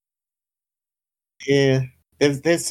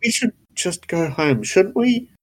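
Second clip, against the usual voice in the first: pitched up, hopeful question; hopeful plea; 6 semitones higher than usual. Acted. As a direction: ask it hopefully, voice rising. GM voices partner, rising.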